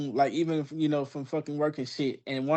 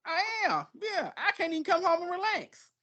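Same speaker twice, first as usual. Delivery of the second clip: much higher, whining and softer; mock whiny reply; 3 dB quieter than usual. To his partner, a higher whiny complaint. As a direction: whine that you get no rest. Answers as himself, mock whine.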